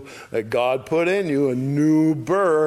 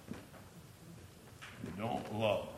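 "They don't love" dropped very soft and very slow; slow, soft repeat of "They don't love" to drive it home; quiet emphasis; 3 semitones lower, 18 dB quieter, much slower than usual. Shown as a demonstration. Teacher repeats point for emphasis.

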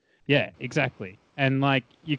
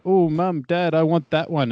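put-on higher, louder child begging parents; imitated kid's pleading, pitched up; excited kid; 6 semitones higher, 7 dB louder, slower than usual. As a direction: put on an eager kid pestering Mum and Dad. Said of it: "kids walking past your stall and being like".

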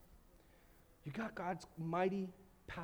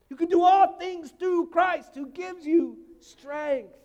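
slow, loud and high proclamation; preaching at full force; 7 semitones higher, 12 dB louder, slower than usual. Real, not acted. Pastor shouting the scripture to the congregation. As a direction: shout the verse like a battle cry.

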